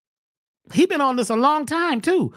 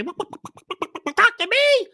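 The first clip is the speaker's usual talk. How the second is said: high, very slow ghostly call; eerie call; 11 semitones higher, much slower than usual. Acted. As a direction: stretch it high like a voice in a dream. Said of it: "The chickens was calling me".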